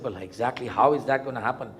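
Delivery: usual speaking voice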